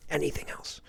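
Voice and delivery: breathy whispered aside. Real, not acted. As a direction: drop to a whisper. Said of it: "whispered repeat".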